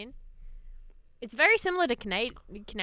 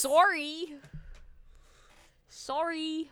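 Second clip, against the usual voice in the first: mock Canadian accent; 6 semitones higher, much slower than usual. Shown as a demonstration. Showing how Canadians sound, high mock Canadian accent. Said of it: "Canadians sound like".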